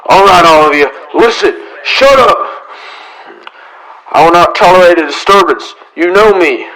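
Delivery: loud, firm order over the noise; commanding shout. Acted. Marshal shouting down the angry crowd at the door, commanding. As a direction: shut the crowd up with authority.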